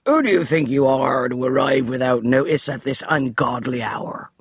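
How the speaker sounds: lightly voiced cranky old servant; affronted servant at night; indignant butler. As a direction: scold the late arrivals. Finch voiced.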